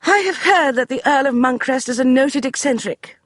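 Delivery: high, brisk dismissal; imperious lady; commanding lady. Acted. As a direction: brush him aside like a general. Beatrice voiced.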